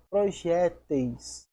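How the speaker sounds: "projéteis" drawn out, ending "eis" clear; slow clear plural. Shown as a demonstration. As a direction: stretch out "projéteis" slowly. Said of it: "esse il ... alteração pra eis". ("projéteis" devagar, destacando o "eis")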